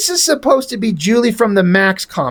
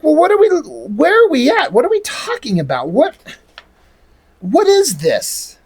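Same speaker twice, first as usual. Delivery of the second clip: high, swooping, baffled questioning; exasperated confusion; 7 semitones higher than usual. Acted. Reviewer reacting to nonsensical comic dialogue, exasperated and confused. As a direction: act totally lost, pitch swinging up high.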